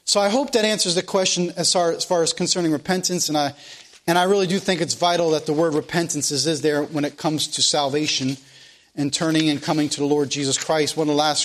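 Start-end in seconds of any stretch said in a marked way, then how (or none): none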